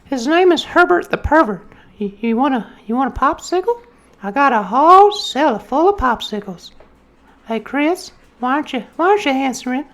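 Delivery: put-on very high, creepy old man voice; Herbert the Pervert. Cartoon pervert calls to Chris, very high and creepy. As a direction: lure them in with a creepy high croak. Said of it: "The voice is straight out of South Park".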